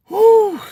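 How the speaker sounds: high, loud whistle-like whew of amazement; amazed whew. Real, not acted. Admiring his old teacher, a loud high whew. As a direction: let out a big high whew.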